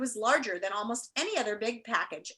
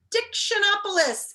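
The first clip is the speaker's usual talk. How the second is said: high, louder announcement; grand reveal; 10 semitones higher, 5 dB louder than usual. Acted. Reader revealing the chosen land, high loud reveal. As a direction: unveil the name like a fanfare.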